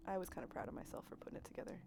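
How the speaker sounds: fast, bashful whispered aside; shy whisper. Real, not acted. Streamer whispering, proud of her overlays, shyly. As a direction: rush it out under your breath, embarrassed.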